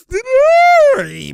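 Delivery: very high, loud whoop; piercing shriek of delight at the play; excited squeal. Real, not acted. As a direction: squeal out at the basket. Reacting to a made basket.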